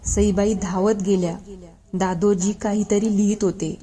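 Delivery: ordinary delivery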